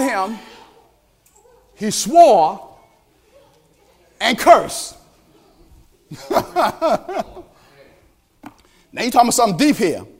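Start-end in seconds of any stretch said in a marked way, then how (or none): 6.1-7.4 light laugh. Real, not acted. Laughs after Peter cursed.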